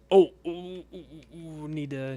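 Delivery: startled high panicked yelps; frightened gasping ooh ooh; scared. Real, not acted. Creature coming after him.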